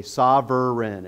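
"sovereign" said slowly as sov-er-eign; three-syllable sovereign. Shown as a demonstration. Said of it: "You have to say it that way".

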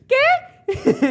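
very high, squeaky little ¿Qué?; squeaky neuron voice. Retold or imitated. Mocking Gigi's baffled neurons with a high squeak. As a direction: play a baffled brain cell squeaking. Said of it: "Y las neuronas así".

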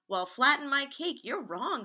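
acted outburst of disagreement; indignant retort. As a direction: push back hard, as in a children's-story squabble. Animals quarrel over the yarn.

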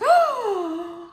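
long, high, loud hoot; high drawn-out owl call. Acted. Owl demonstrates sound in tree.

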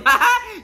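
high-pitched, sudden burst of laughter. Real, not acted. Laughs after joke about own gender.

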